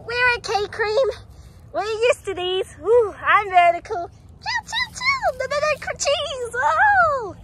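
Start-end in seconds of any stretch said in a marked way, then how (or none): 4.4-7.3 put-on high, excited child voice; tiny plushie rabbit voice; squeaky little girl voice. Voicing Cream, high pitched.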